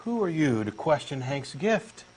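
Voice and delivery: normal talking voice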